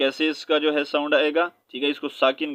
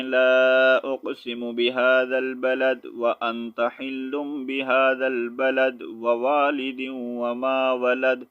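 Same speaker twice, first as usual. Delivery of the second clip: in one breath; much slower than usual. Shown as a demonstration. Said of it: "جیسا کہ لوگ پڑھتے ہیں".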